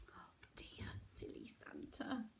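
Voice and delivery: soft, whispery tutting; very soft, whispered dismay; hushed aside. Acted. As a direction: breathe a quiet aside to the children. Storyteller's hushed aside on Santa.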